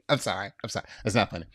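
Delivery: ordinary delivery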